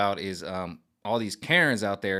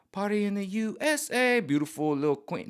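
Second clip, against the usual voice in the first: much higher, sing-song princess voice; 10 semitones higher than usual. Retold or imitated. Mocking [name]'s Disney days.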